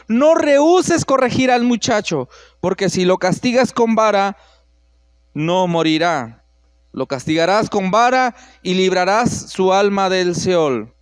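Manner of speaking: scripture reading. Acted. Reads scripture aloud.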